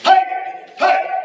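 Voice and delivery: sudden very loud yell; ki shout. Shown as a demonstration. Instructor breaking the grab demo with a ki shout. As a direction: blast a short fighting shout.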